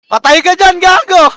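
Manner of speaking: high, loud burst of trash talk; heated gamer shout; shouted taunt. Real, not acted. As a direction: yell it at an enemy you are killing. Taunting an enemy in a kill.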